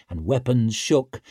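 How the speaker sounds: plain reading voice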